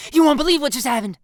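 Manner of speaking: fast, loud, high gush; breathless dramatic blurt. A narrator's reading. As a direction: burst out with big news. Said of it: "she blurts out".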